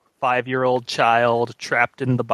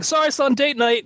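mock dad voice; 12 semitones higher than usual.

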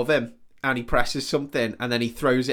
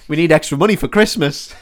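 put-on louder child and mum voices; mock family scene; 3 semitones higher, 7 dB louder than usual. Friend invents the soldier's family, hammed-up voices. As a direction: play a kid asking mum.

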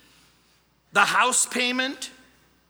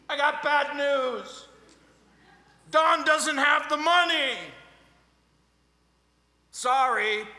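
shouting out the window; 6 semitones higher than usual. Retold or imitated. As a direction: yell it out the window to wake Sam.

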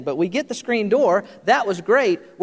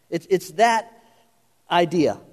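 ordinary talk, nothing marked

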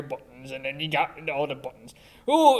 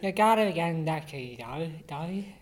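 put-on Irish accent.

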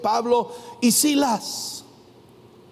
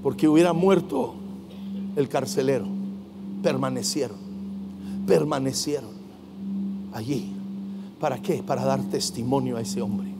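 low, soft, with long pauses; hushed solemnity; 9 semitones lower, 6 dB quieter than usual. Real, not acted. Preacher explains why they stayed for the jailer, hushed. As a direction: let each phrase sink in quietly. Solemn hush after shouted climax.

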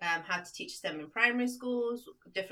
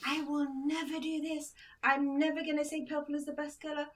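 higher-pitched defiant student; mock defiance; 6 semitones higher than usual. Teacher retelling a student's refusal to be brainwashed, mock defiant. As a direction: imitate a stubborn pupil's vow.

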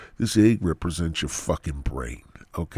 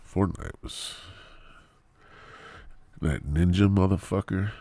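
normal talking voice

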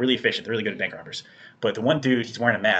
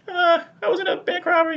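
high, loud imitated girly voice; mock timid woman; 5 dB louder than usual. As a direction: squeak it out like the shaken teller. Voicing the teller's reply.

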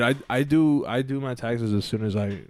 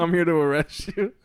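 high loud goofy officer; mock cop voice; 6 semitones higher, 5 dB louder than usual. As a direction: play a silly cop. Second take of fake officer.

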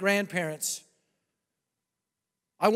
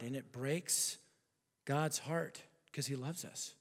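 much softer, lower, intimate invitation; hushed altar call; 9 semitones lower, 13 dB quieter than usual. Acted. Preacher inviting the unsaved to Christ, softly and intimately. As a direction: lean in and speak to each listener alone.

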